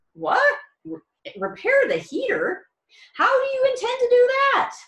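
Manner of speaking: high, loud disbelieving squeal; shocked and amazed. Shown as a demonstration. Teacher showing incredulous to listeners, shocked high squeal. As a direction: shriek it out in total disbelief. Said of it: "you're, like, shocked and amazed".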